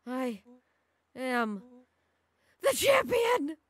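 triumphant shouting. Acted. Race winner boasting to the runner-up, building triumphant shout.

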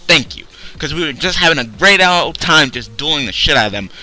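normal talking voice

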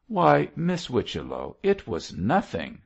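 plain reading voice

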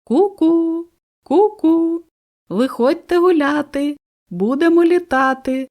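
high, loud, carefree cuckoo call; teasing cuckoo. A narrator's reading. Cuckoo teasing the busy birds, high and carefree. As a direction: tease the nesting birds in a cuckoo voice. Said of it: "всіх дражнила".

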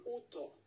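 Otto backwards, high falling; backwards speech. Shown as a demonstration. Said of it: "weil Otto wird rückwärts gesprochen". (Otto rückwärts gesprochen)

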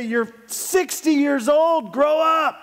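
high, exasperated mock telling-off; mock scolding. Pastor imagines scolding a sixty-year-old golfer, high mock rebuke. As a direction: scold him in exaggerated exasperation.

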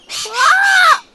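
very high loud squawk; parrot squawk. A narrator's reading. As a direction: screech it like a parrot. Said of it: "flew across the path and squawked".

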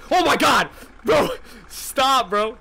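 high loud yell; frightened yell. Real, not acted. Streamer scared by coworker, yelling. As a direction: yell at him to stop.

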